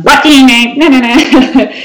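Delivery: high-pitched mock yell; half-shouted, high, dismissive retort; mock shouting. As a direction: re-enact snapping at unwanted advisers. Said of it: "shouting at them".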